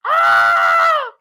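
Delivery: high, loud shriek of excitement; excited scream. Real, not acted. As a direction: let out a squeal of joy. Excited scream about dream.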